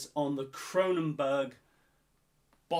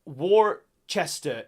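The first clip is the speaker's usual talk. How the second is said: normal talking voice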